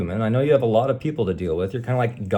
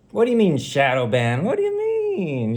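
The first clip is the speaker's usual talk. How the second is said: mock innocent denial; 8 semitones higher than usual. YouTube algorithm feigning innocence, mocking. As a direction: play dumb in a falsetto squeak.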